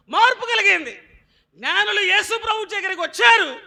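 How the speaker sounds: high, loud shouted sermon; full-force pulpit shout; fiery preaching. Acted. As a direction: drive it home to the church.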